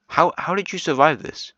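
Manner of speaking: high-pitched astonished question; real disbelief, voice jumping up high; surprised disbelief. Real, not acted. Shocked at a country surviving.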